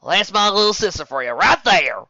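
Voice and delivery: loud, high proud cheer. Acted. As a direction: brag about her little sister loudly. Applejack praising her sister.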